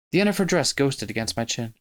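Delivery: usual speaking voice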